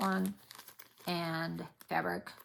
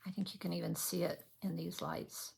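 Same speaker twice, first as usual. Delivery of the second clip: normal talking voice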